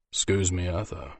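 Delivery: meek polite interruption; humbly. Acted. Wounded griffin humbly addressing the mage, meek. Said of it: "he asked humbly".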